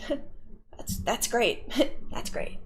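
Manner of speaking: breathy laugh through the words, soft. Real, not acted. Reacting to chat's cuck videos remark, soft laughing. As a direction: barely get it out for laughing.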